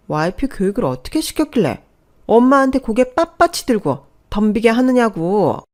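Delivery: high, loud, haughty complaint; scornful anger. Acted. Sister-in-law urges brother to discipline his wife, indignant. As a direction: rail at her, then demand backup.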